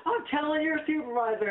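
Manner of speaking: high-pitched mocking tattletale; mimicked indignant threat. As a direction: imitate her threatening to report you. Mimics offended lady's threat.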